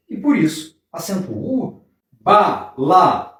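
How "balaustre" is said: "Balaustre" split as "Ba... la... us... tre"; syllable by syllable. Shown as a demonstration. Teacher dividing the word for students, "Balaustre" syllable by syllable. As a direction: say "Balaustre" in four separate beats. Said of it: "A sílaba tônica é esta".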